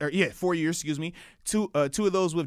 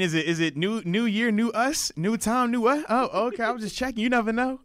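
teasing; 8 semitones higher, 3 dB louder than usual. Pretend. Teasing the audience.

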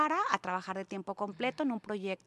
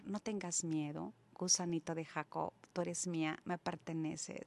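soft, lower, tender reassurance; tender divine voice; 3 semitones lower, 9 dB quieter than usual. Retold or imitated. The Lord speaking through Isaiah, tender and hushed. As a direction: read it gently, hushed and unhurried. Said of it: "en la que me decía".

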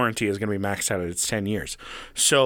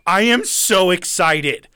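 mock excited; 6 semitones higher, 7 dB louder, slower than usual. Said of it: "I'm like".

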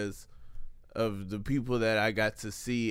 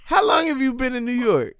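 high, loud surprised questions; 12 semitones higher, 11 dB louder than usual. Real, not acted. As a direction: shriek it in disbelief. Surprised friend in New York.

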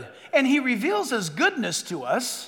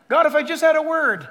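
higher, louder mock begging; pleading; 5 semitones higher, 5 dB louder than usual. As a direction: plead loudly with God. Pleading believer begging God.

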